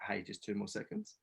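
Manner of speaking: hushed nudge to hold on; calm inner voice. Retold or imitated. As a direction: imitate a quiet voice in the head. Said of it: "the voice that said".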